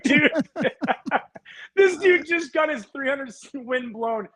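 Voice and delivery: high, slow, cracking up mid-sentence; can't get the words out for laughing; laughing through it. Real, not acted. Telling the host Maddux's golf excuse, laughing hard.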